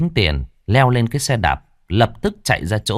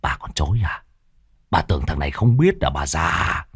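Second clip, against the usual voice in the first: hissed through teeth; 5 semitones lower than usual. A narrator's reading. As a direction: hiss each word, low and menacing. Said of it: "rít lên từng chữ từng chữ". (rít lên từng chữ, đe dọa)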